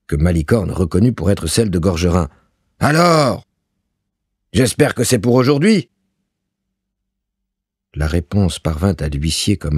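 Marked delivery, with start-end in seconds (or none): 2.8-5.9 high, impatient landlord demanding his due; gruff arrogant voice. A narrator's reading. Landlord demanding rent from a poor seamstress, gruff and impatient. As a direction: demand it roughly, voice pitched up. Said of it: "dit une grosse voix rogue".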